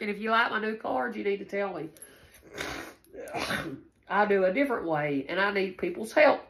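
usual speaking voice

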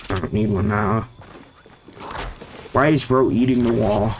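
ordinary talk, nothing marked

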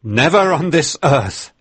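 high, loud defiant cry; cried out. Acted. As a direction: hurl the refusal at the room. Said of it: "he cried".

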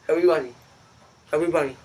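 talking through marshmallows; words muffled by a stuffed mouth; mouth full. Real, not acted. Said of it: "I'm gonna chew it".

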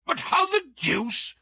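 high, gasping disbelief; gasped. A narrator's reading. The cornered Count gasping in shock at Holmes. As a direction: gasp it out, thrown off balance. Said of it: "he gasped".

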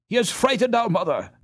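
alarmed cry. A narrator's reading. Hans running home to his mother, a mildly alarmed cry. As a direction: blurt it out in fear. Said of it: "he cried".

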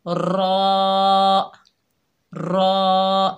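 thick, rounded रॉ; trilled, heavy रॉ; heavy raw. Shown as a demonstration. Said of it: "हमारी आवाज़ ऊपर उठेगी".

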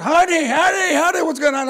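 high loud alarmed calls of honey; a worried wife trying to calm him, loud; wife calling out to her furious husband. Retold or imitated. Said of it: "my wife is like".

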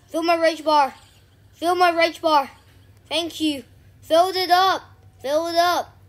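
very loud, high-pitched yelling; excited shouting. Real, not acted. Gamer yelling to fill the rage bar, excited shouting. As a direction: shout it out at full voice. Triumphant shouting during play.

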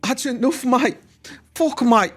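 high-pitched mock panic; stressed, nervous kid. London kid dreading the army exam, mock stressed. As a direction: imitate a tense kid freaking out. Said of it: "stresli gergin bir çocuk vardı".

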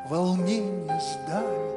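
ordinary delivery

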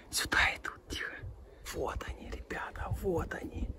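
very soft excited whisper; whispering while hiding. Real, not acted. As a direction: whisper so the herd stays close. Horses close, hiding.

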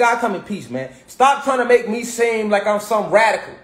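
normal talking voice

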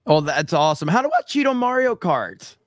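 ordinary delivery

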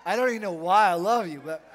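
loud, high, laughing declaration of love; joyful loud quote. Retold or imitated. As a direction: cry it out with glad abandon. Voicing a Spirit-filled believer.

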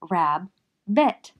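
"Rab-bit" in two separate beats; loud, measured "Rab-bit", syllable by syllable; clapped-out syllables. Shown as a demonstration. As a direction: pause between the halves of "Rab-bit". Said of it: "Clap it out".

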